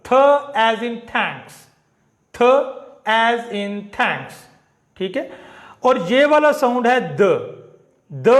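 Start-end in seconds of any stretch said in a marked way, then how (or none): 0.0-1.5 th as in thanks, said slowly; teacher demo of थ; sound th. Shown as a demonstration. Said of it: "इसको हम पढ़ते हैं थ". 2.3-4.3 थ as in thanks, drawn out very slowly; slow teacher repeat. Shown as a demonstration. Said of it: "इसको हम पढ़ते हैं थ".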